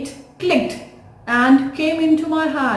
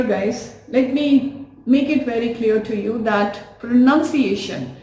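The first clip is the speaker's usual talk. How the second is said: ordinary delivery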